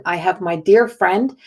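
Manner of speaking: ordinary talk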